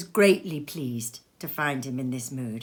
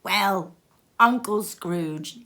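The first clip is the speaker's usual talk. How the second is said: louder, slow toast of Uncle Scrooge; group toast; 3 dB louder, much slower than usual. Acted. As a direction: cheer it like a roomful of guests. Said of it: "they cried".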